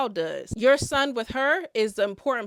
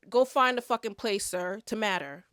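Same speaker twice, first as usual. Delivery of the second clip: ordinary talk, nothing marked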